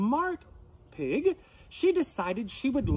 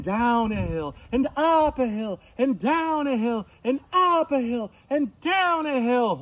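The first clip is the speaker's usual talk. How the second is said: sing-song, growing higher and louder; rising refrain; 6 semitones higher, 6 dB louder than usual. A narrator's reading. Storyteller leads children over the hills, sing-song and building. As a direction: build it with every hill climbed.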